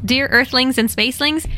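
ordinary delivery, nothing marked

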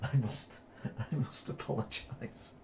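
soft, breaking up with real giggles; stifled laughter. Real, not acted. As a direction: apologise through suppressed laughter. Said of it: "why is diarrhea funny".

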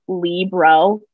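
"Libro" as LEE-bro, two slow syllables; wrong two-syllable version. Shown as a demonstration. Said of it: "the other wrong answer".

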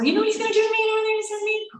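high-pitched mock screaming; retold screaming. Patient screaming around the hospital about husband, retold hysterics. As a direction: wail it high without full volume. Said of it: "started screaming around the hospital".

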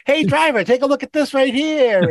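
high, chirpy passenger voice; lightly voiced college girl; young woman voice. Uber passenger quote, high voice.

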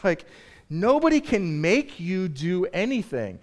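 ordinary delivery

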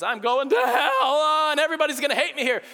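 high, loud, rushed mock panic; laughing high-pitched fake alarm. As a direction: ham up the worried listener.

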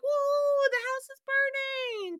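high, loud mock whoop; gleeful cheer. Old couple in the canoe watching the house burn, gleeful whoop. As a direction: cheer the fire like a delighted spectator. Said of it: "they're out on the water in the canoe like".

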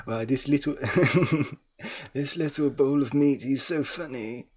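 lightly voiced old man; a hint of an old geezer, amused. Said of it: "I can't do an old man voice".

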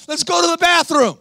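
high mock-screamed woman's voice; retold screaming. Woman in my office to her counselor, imitated screaming. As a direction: keep imitating her high, urgent shriek. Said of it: "She starts screaming. She goes".

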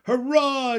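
high, loud cheer; froglets shouting. A narrator's reading. Froglets cheer the rescue plan, shouting. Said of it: "shouted the froglets".

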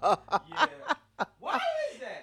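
high, laughing question. Real, not acted. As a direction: ask it while cracking up. Laughing at the funny story.

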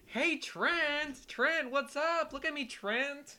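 high, slow, drawn-out heckle; pestering fan holler. Retold or imitated. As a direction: beg for the player's attention from the stands. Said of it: "leaning over and like".

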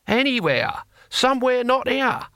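high-pitched, impatient dismissal; exasperated. Acted. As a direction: shoo them away in a high, fed-up voice. Cohen exasperated by clinging ex-prisoners.

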